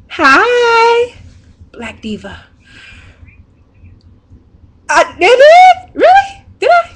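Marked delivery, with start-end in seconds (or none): 0.1-1.1 high, drawn-out squealed hello; saluto entusiasta. Real, not acted. Greets friend on the phone. 4.9-6.9 very high, loud squeals of disbelief; delighted surprise. Real, not acted. Delighted surprise during phone call.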